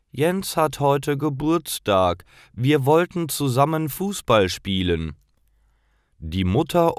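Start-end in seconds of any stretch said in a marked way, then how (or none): 0.1-5.2 traurig. Acted. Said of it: "Felix antwortet traurig".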